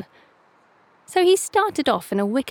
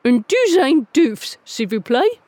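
careful, proud schoolbook French; 4 semitones higher, 5 dB louder than usual. A narrator's reading. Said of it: "he said proudly".

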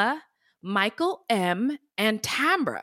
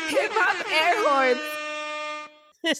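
mock hype announcement; 5 semitones higher, slower than usual. Mock sound effect cue after tunes.

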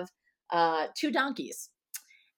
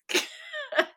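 embarrassed giggle. Real, not acted. Laughs after singing.

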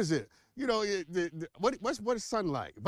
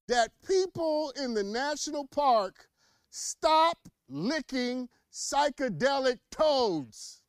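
preacher voice; 5 semitones higher, 4 dB louder than usual. Pretend. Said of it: "gotta get my preacher voice for this".

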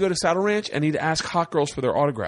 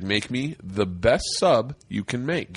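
low, drawn-out order, word by word; imitating a deadpan, weighty demand; slow, deliberate repeat; 5 semitones lower, slower than usual. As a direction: repeat it slowly as if to someone who didn't get it. Repeats order after "Excuse me?.

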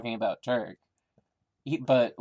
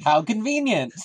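much higher, loud mock amazement; sarcastic; 10 semitones higher, 8 dB louder than usual. Real, not acted. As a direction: drip with sarcasm. Sarcastic comment on plot.

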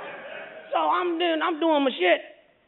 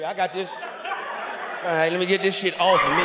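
put-on self-coaching drunk; 6 semitones lower than usual. Drunk driver coaching himself to sober.

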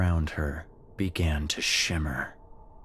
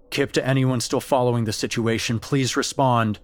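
higher, louder and fast, pressing for a reply; urgent radio call; 5 semitones higher, 5 dB louder, faster than usual. Acted. Security guard urging anyone on the radio, urgent and fast. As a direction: rush the call out.

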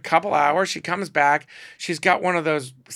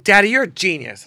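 louder, higher gushing praise; thrilled; 7 semitones higher, 8 dB louder than usual. Retold or imitated. Calling him on the phone, gushing and delighted.

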